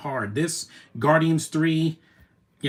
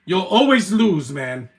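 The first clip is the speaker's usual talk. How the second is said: loud, slowed taunt; mocking shout; 4 semitones higher, 6 dB louder, slower than usual. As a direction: rub the loss in at full voice. Taunting a movie character on screen.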